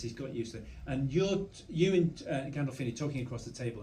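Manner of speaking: ordinary talk, nothing marked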